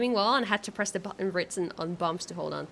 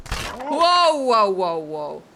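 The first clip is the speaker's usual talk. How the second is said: startled whoa; 8 dB louder, much slower than usual. Real, not acted. Slipping while climbing.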